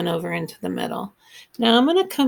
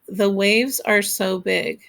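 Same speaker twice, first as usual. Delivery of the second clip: slow haiku reading. Shown as a demonstration. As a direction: read the line slowly, syllable by syllable. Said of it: "That's five syllables".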